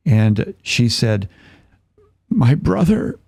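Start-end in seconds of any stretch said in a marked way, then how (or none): 2.3-3.2 high, tearful retelling; high-pitched mock-tearful voice; imitated crying. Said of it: "she's crying".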